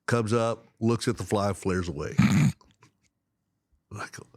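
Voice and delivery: normal talking voice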